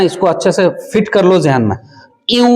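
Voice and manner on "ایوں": ایوں pitched high with a nasal glide; higher recitation tune. Shown as a demonstration. Teacher repeats the ایوں tune higher. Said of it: "یہ ایوں والا جو طرز ہے".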